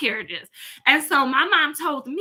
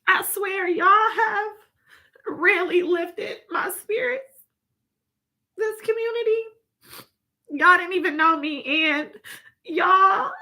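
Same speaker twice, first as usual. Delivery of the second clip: high, slow, voice close to tears; getting emotional; 7 semitones higher, slower than usual. Real, not acted. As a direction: thank them while fighting back real tears. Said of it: "I'm getting emotional".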